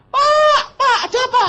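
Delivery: very high, loud mock alarm; panicked maid. Maid waking the boss after theft, panicked cries. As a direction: shriek it high in hammed-up panic.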